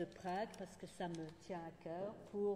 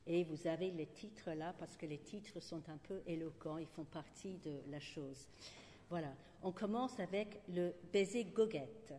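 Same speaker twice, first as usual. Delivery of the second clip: usual speaking voice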